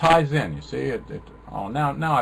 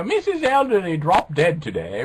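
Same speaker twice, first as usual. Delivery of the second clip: chirpy phone caller; 7 semitones higher than usual. Pretend. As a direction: play a cheery caller breaking bad news lightly. Acting out a caller reporting a death.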